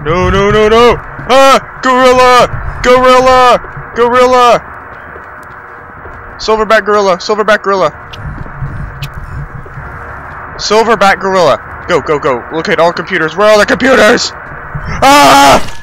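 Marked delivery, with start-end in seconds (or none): 0.0-11.6 high, frantic cries of alarm; panicked yelling. Real, not acted. Player spotting a gorilla chasing them, panicked yelling. 13.4-15.7 high, frantic question breaking into a scream; panicked shriek. Real, not acted. Player hunting computers while chased, panicked shriek.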